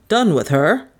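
indignant echoed question, rising at the end. A narrator's reading. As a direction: throw the question back, mildly affronted. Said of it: "he said indignantly".

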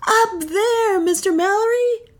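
high, urgent shout; bawling. Acted. Pat bawls to Mallory pointing skyward, high urgent shout. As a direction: bawl it out from across the track, pitched high. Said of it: "bawled Pat".

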